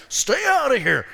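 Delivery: loud, high commanding rebuke; shouting the devil out of the room; acted rebuke. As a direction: drive the devil off loudly. Resisting the devil aloud.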